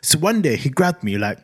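normal talking voice

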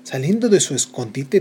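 ordinary delivery

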